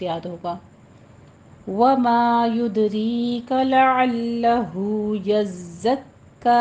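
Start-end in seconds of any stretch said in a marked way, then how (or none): 1.7-6.6 slow measured Quranic chant; tajweed recitation. Shown as a demonstration. Teacher models the verse for students, slow recitation. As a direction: draw each vowel out like a reciter.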